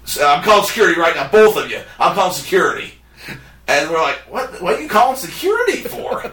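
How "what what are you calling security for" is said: high laughing disbelief; incredulous. Retold or imitated. Friends baffled as the clerk calls security, high and laughing. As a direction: squeak it out, half laughing.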